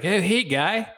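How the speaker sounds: lightly voiced Canadian professor; Canadian professor impression. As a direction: do the Canadian prof saying guy. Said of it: "He was always like".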